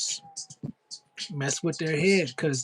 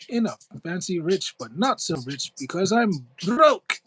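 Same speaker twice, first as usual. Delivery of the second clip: put-on posh British accent; 2 semitones higher than usual. A streamer hams up a posh British accent for chat. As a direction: put on a rich Brit for the chat. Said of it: "They said I sound like a posh Brit".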